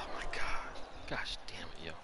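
breathy, hushed, slowed groan of frustration; whispered frustration after a bad shot; whispered curse. Real, not acted. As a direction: mutter it under your breath in dismay.

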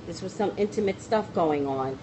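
usual speaking voice

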